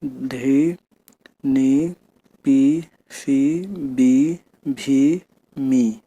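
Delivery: each syllable drawn out slowly on its own; slow syllable reading. Shown as a demonstration. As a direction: read the syllables one by one slowly for the pupils. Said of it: "ये एक बार में जोड़कर पढ़ा जा रहा है". (धीरे-धीरे एक-एक अक्षर पढ़ना)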